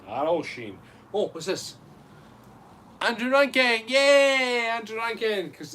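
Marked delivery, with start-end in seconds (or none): 3.0-5.5 high, loud celebratory shout-out; cheering. Real, not acted. Host welcoming a new Patreon supporter, cheering.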